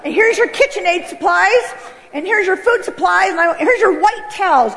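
high, loud, brisk-toned imitation; bossy mother-in-law. Mother-in-law equipping her son's wife, high mock-bossy. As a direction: hand out supplies like a commanding matriarch.

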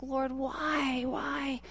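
very soft crying plea to God; tearful plea. Real, not acted. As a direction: barely voice it through tears. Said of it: "And I cried".

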